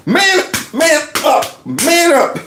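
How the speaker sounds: high, drawn-out taunt; mocking jeer. Real, not acted. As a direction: jeer at him to toughen up. Jeers at crying man on clip.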